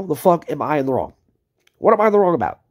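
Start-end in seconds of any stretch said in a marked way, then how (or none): 1.8-2.6 louder, higher angry demand. Real, not acted. Streamer demanding answers from rival Daniel, angry and loud. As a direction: hammer the question at him. Confronting rival in heated rant.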